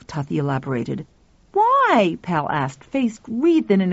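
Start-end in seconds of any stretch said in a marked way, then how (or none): 1.5-2.2 put-on excited boy. Pal excited.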